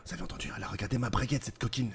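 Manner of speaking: soft, rapid, conspiratorial aside; hushed excitement. Pretend. Smitten man confiding to his captain, hushed excitement. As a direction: rush it out under your breath, thrilled.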